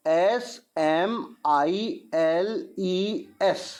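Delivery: letters एस एम आई एल ई एस drawn out one by one, slowly; slow spelling. Shown as a demonstration. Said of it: "इसका answer मैं आपको बताता हूँ".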